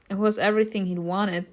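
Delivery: ordinary talk, nothing marked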